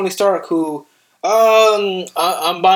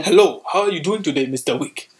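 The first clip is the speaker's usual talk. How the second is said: put-on a light African accent, polite and formal. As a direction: greet Mister Wick lightly in an African accent. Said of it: "like the accent that, that, uh, Sharon uses in John Wick".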